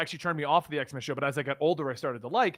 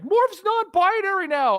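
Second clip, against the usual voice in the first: high, slow, loud mock gushing; mocking fans; 12 semitones higher, 5 dB louder, slower than usual. Host parodying fans announcing Morph, hammed-up. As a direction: ham up an excited fan.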